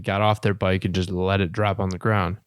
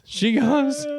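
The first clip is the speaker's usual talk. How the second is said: laughing. Real, not acted. Teller cracking up mid-story.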